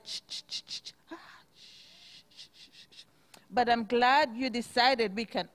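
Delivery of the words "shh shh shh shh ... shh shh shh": whispered shh to quiet the class; shushing. Real, not acted. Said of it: "hushing the children".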